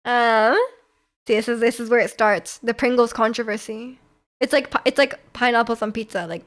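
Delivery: normal talking voice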